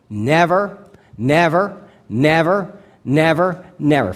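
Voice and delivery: each "never" punched separately, rising until the last one falls; emphatic repetition. Shown as a demonstration. Preacher counting out God's five-fold promise, each "never" stressed emphatically. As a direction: hammer every "never" as its own emphatic beat. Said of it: "it's said in the most emphatic way".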